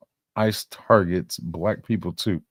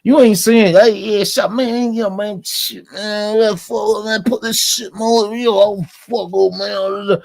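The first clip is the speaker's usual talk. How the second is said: high, loud, rapid-fire mock slang, man, yo, shit; mock street slang voice; 13 semitones higher, 7 dB louder than usual. Streamer mocks what people expect him to sound like, hammed-up high slang voice. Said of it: "when they hear me talk".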